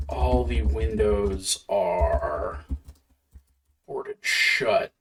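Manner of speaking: slow, soft scene setting; slow narration. Acted. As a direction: describe the scene slowly.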